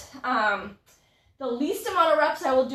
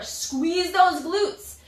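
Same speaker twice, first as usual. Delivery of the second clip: drawn-out cue; 4 semitones higher, much slower than usual. Shown as a demonstration. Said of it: "coach cue, drawn out".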